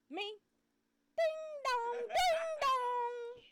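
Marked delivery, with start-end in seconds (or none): 1.2-3.4 high singsong ding dong; mock doorbell ding dong; sung doorbell chime. Doorbell imitation in story.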